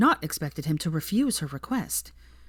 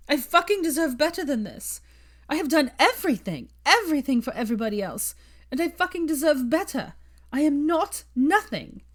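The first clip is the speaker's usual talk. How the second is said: tearful defiance; 10 semitones higher than usual. Acted. Hermione shouting her worth to Draco, tearful and fierce. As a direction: claim it through tears.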